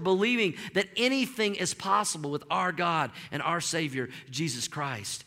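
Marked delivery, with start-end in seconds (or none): none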